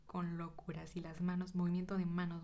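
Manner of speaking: ordinary talk, nothing marked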